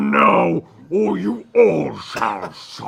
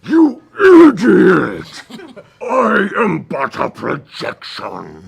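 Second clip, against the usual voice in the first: booming ghostly voice; 5 dB louder than usual. Acted. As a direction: explode on the insult, then intone the rest. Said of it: "you hear a voice come booming".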